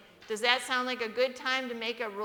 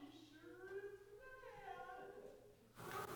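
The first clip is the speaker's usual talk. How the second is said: put-on higher, hushed tease to the kids; conspiratorial aside; 9 semitones higher, 24 dB quieter than usual. Father to kids in back seat, hushed gleeful aside. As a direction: whisper it gleefully. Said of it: "he'd say".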